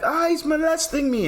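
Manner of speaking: high, squeaky cry from the food. Pretend. Voicing the food.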